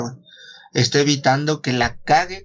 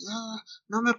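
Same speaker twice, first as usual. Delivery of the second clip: mock dying gasp; 9 semitones higher, 9 dB quieter than usual. Acting out the shot suicide.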